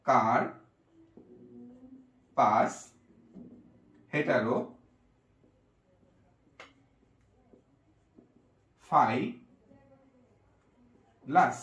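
কার, পাস, hetero, ফাইলাস with long pauses; slow dictation. Shown as a demonstration. Teacher writing the name out for students, slowly. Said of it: "So, আমরা লিখি".

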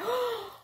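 sharp high gasp; imitating a startled gasp; shocked group gasp. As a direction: gasp in mock astonishment. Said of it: "turned around like".

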